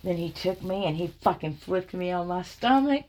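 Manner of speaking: ordinary delivery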